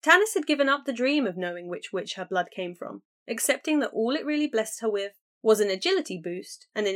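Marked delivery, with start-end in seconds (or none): none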